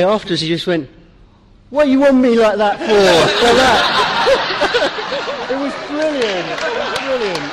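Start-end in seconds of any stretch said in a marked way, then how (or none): 1.7-2.9 high, loud acted outburst; mock exclamation. Acting out the man's reaction. 2.9-7.5 high-pitched, laughing delight; real laughing. Real, not acted. Laughing after his impression.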